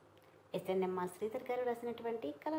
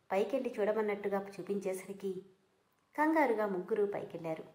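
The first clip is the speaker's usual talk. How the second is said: ordinary delivery, nothing marked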